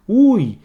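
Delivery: high, loud sharp ouch; sudden cry of pain. Shown as a demonstration. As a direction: yelp as if you just got hurt. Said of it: "uma dor".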